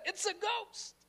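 high-pitched frightened exclamation; terrified cry. Acted. Disciples seeing him walking on the sea, terrified cry. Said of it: "they were terrified and said".

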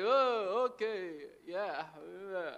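put-on grave nodding along, trailing off; mock serious. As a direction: feign solemn understanding without a clue. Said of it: "When they're serious".

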